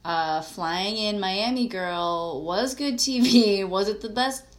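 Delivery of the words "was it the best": louder, higher, teasing; playful call-out. Real, not acted. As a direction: call out to her fondly. Calling out to Kristen.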